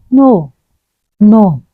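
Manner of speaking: normal talking voice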